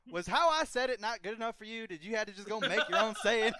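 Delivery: offended, hammed-up Southern boss, breaking into a laugh; Southern Joe Bennett. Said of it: "she's like".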